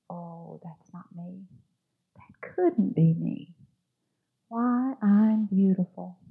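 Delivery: hushed, slow disbelief at own beauty; amazed wonder. Acted. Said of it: "And I said".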